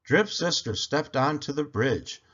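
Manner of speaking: ordinary delivery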